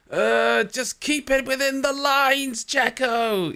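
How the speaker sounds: lightly voiced team boss, scolding; playful impression of an annoyed team principal. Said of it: "he's like".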